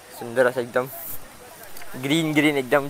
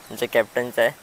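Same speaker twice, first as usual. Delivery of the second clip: ordinary talk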